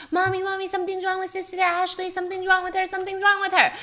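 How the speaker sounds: put-on high, childlike frantic calling; a small child running to tell mom something's wrong; little girl panicking. Little sister crying out to her mom, panicked and high.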